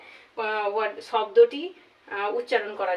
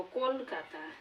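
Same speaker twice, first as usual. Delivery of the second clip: Kolkata in three soft beats, kol-ka-ta; three-beat syllable demo; 8 dB quieter, much slower than usual. Shown as a demonstration. Teacher showing a three-syllable word, Kolkata softly split as kol-ka-ta. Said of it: "তিনবারে এটিকে উচ্চারণ করতে পারবো".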